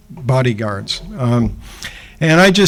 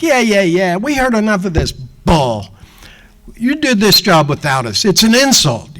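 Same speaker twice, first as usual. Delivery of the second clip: higher, scornful tough-guy rant; mock angry thug; 6 semitones higher than usual. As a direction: brush him off like a hotheaded mobster. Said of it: "this guy's like".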